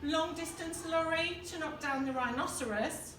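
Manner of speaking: usual speaking voice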